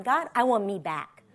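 low, soft prayer; quiet resolve. Retold or imitated. Retelling a lunchtime prayer, quiet resolve. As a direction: say it low and hushed to God. Prayer retold, first take.

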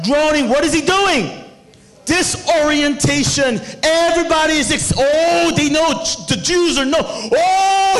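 high, shouted sermon delivery; loud, sustained preacher's cry; full-force preaching. Real, not acted. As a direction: work the congregation up at full volume.

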